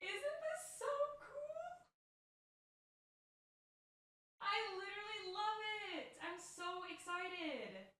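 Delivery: soft, very high drawn-out squeal; excited squeal. Real, not acted. Crafter showing off the box, in a soft high squeal. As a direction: squeal it quietly, slow and high.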